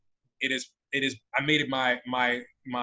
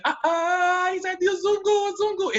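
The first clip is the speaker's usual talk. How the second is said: imitating the seller's calls, much higher and louder; high calling out; 3 dB louder, slower than usual. Seller calls after the bargaining customer in a high, loud taunt. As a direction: sing out the taunt in a high, carrying voice.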